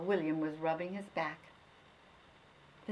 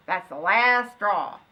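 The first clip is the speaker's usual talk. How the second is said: slow, louder voice of an angry farmer; fed-up farmer; 11 dB louder, slower than usual. Acted. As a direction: lay down the law as the farmer. Farmer fed up with old dog.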